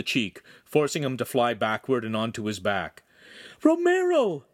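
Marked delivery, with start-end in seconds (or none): 3.6-4.5 very high, loud cry of alarm; scream. A narrator's reading. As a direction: scream his name in horror. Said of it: "Giulietta screamed".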